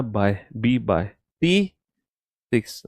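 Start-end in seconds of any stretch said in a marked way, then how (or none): 0.0-1.1 बाय said slowly and clearly as "bye", not "वाई"; slow down and sound out बाय; correct pronunciation. Shown as a demonstration. Teacher correcting students who said वाई, slow bाय. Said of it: "वाई नहीं, बाय बोलना".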